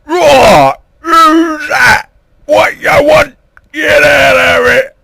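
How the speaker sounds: very high, loud screaming; hammed-up falsetto roar; roaring Queen. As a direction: shriek it wildly as a caricature queen. Queen acted roaring at intruder.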